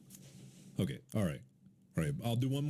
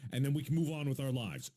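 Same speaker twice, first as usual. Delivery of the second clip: ordinary talk, nothing marked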